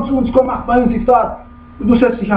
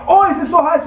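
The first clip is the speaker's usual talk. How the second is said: mock whining, higher pitch; exaggerated high moan; grumbling complaint; 6 semitones higher than usual. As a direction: imitate someone griping about heat. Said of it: "und dann schimpft er".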